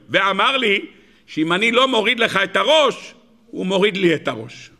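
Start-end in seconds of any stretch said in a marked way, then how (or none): none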